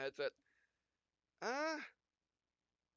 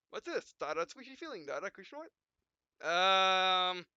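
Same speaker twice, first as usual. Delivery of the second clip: lightly voiced game hero, dramatic beats; 6 semitones higher, 4 dB louder than usual. Gamer reading the hero's lines and punctuation aloud, lightly dramatic. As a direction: read the dot-dots for drama.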